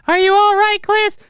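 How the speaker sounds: very high falsetto wife voice; concerned wife. Acted. Wife seeing her battered leopard husband, in mock falsetto concern. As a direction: put on a high-pitched, fretting wife. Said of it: "Oh, his wife sees him".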